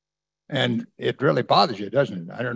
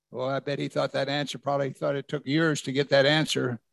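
usual speaking voice

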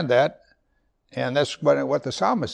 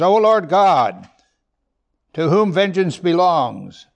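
loud, slow, proclaiming scripture; first take, booming and slow; emphatic reading; 4 semitones higher, 6 dB louder than usual. Acted. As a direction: declare it like a prophet.

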